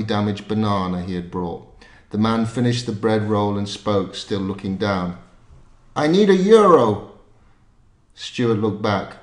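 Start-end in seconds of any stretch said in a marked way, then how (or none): 5.9-7.1 put-on high, loud Scottish accent; bluntly demanding in a Scottish accent. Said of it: "I'm gonna do a Scottish accent".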